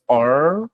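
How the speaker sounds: "ar" ending in a held American r; English R. Shown as a demonstration. Teacher tells students how Americans say the letter, English R. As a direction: hold the untapped r of "ar". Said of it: "Para ellos la R es".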